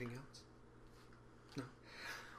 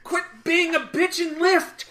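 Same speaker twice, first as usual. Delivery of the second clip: yelling; 13 semitones higher, 10 dB louder, slower than usual. Acted. Yelling at party to lift.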